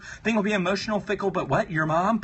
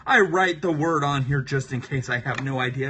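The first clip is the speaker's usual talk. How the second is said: usual speaking voice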